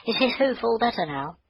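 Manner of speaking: put-on high little girl's voice, hopeful; little girl Maggie.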